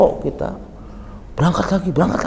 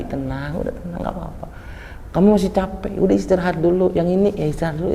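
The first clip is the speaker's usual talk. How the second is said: soft soothing calm; 6 dB quieter, faster than usual. Retold or imitated. Prophet soothing tired pilgrims.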